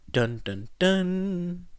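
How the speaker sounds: mock ominous villain jingle; low and melodramatic. A narrator's reading. Hermione joking with the boys, hamming up a dramatic dun dun dun. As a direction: play up a dramatic doom theme. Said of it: "Hermione's voice gone low and melodramatic".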